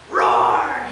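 loud pretend monster roar; playful roar. Lonely unicorn playing make-believe games, loud roar. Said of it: "he'd howl".

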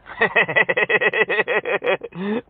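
long, rapid chuckling run; drawn-out staccato laugh. Real, not acted. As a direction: laugh on and on in quick pulses. Prankster laughs at the scammer's refusal.

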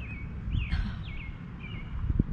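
airy squeal of laughter; breathy high laugh. Real, not acted. Laughing after adventure line.

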